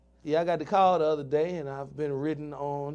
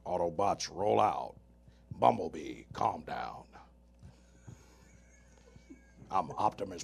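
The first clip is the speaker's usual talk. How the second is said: low, deep heroic robot leader voice; Optimus Prime impression; 9 semitones lower, 6 dB quieter than usual. Impressions for his cohost, a low Optimus Prime. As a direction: rumble it low and commanding. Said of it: "I can do Optimus Prime".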